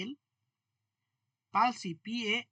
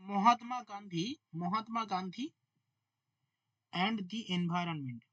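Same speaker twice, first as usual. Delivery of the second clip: slow dictation; slower than usual. Shown as a demonstration. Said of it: "dictating title word by word".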